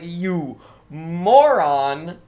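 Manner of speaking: mocking yell. Other dogs mocking the dog.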